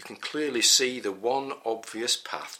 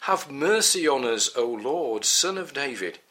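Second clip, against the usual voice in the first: raised, higher and louder plea; imploring call, pitched up and loud; 7 semitones higher, 5 dB louder than usual. A narrator's reading. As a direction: cry out for mercy to someone passing. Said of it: "they cried out all the more".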